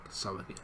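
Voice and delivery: "Sawagin" repeated quietly as SA-wa-gin; confirmed pronunciation. Shown as a demonstration. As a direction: softly confirm "Sawagin" to yourself. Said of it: "I believe that's how you pronounce it".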